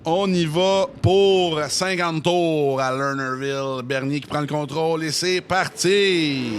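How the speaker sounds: loud play-by-play launch of the race; race start call. Acted. Commentator calling the green flag, loud and keyed up. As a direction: announce the start with full force.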